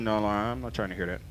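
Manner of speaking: mock refusal, muttered low. Preacher retelling how he dodged God's call, mock low mutter. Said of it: "went home and said".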